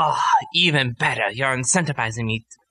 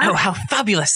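over-the-top excited exclamation; gushing delight; 8 semitones higher than usual. Acted. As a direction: gush like a delighted socialite. Alchemist reacts, much more excited.

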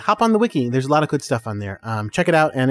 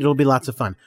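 ordinary talk, nothing marked

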